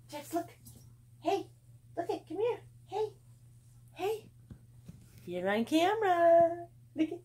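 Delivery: high, loud sing-song call to the dog; delighted squeal when he finally smiles. Real, not acted. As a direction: coax him to look, squealing high. Calling the dog to camera.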